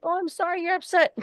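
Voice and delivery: very high, fast, sing-song fake sympathy; mock sympathy. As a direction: parody a patronizing comforter in a squeaky put-on voice. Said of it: "somebody's gonna be like".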